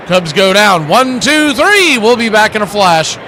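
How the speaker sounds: play-by-play. Acted. Calling the game play-by-play.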